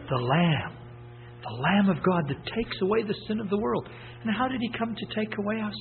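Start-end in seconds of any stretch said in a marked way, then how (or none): none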